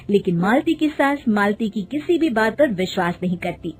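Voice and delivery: ordinary delivery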